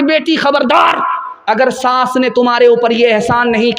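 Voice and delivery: high sharp warning call; stern warning. Acted. A mother warns her daughter about the mother-in-law, a high stern warning.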